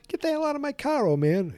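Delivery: very high fast bratty yell; young Biff voice. Retold or imitated. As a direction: snap it like a punk.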